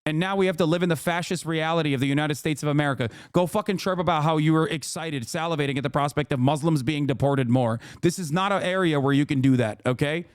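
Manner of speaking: ordinary talk, nothing marked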